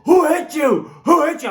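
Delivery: high, loud furious shout; furious dad shout. Retold or imitated. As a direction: bellow it, hunting for the culprit. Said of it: "my dad fires up in a way like I have never seen before".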